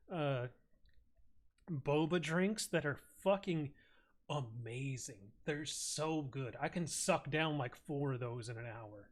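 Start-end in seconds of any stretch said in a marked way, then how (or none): none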